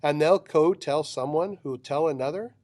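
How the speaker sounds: ordinary delivery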